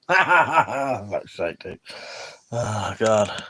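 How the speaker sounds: slow words through real laughter; laughing. Real, not acted. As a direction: laugh it out slowly, amused by the joke. Laughing at a rude chat message.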